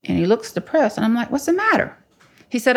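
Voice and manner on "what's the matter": much higher-pitched concern, retold; concerned questioning. Woman asking a depressed-looking friend, high-pitched concern. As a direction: ask it high and puzzled.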